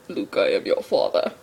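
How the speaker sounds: low, breathy Vader line, lightly done; a hint of a masked villain father; Darth Vader impression. Fan quoting Star Wars, low and breathy. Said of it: "had to be done".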